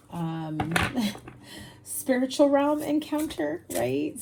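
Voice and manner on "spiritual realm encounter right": laughing through the words, higher. Real, not acted. Tarot reader to viewers, laughing at her own stumble. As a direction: giggle as you fix the phrase.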